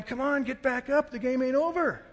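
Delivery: loud, high relatives' holler; imitated group yelling. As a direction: yell encouragement like a whole family. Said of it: "they're all yelling".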